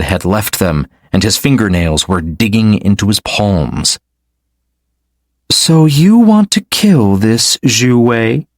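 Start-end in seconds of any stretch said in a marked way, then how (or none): 5.5-8.5 slow, high, sweetly teasing; sweet smiling. Acted. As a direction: smile through the question. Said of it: "a twinkle in her eyes and a sweet smile".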